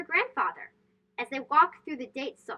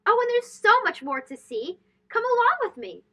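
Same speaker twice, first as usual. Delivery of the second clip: bright, higher sing-song call to come along; excited invitation; 7 semitones higher, 4 dB louder than usual. A narrator's reading. Narrator calling young listeners onward, bright and excited. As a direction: lift it up like beckoning a child to follow.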